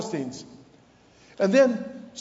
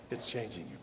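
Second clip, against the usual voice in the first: soft echo; 11 semitones lower, 13 dB quieter than usual. Real, not acted.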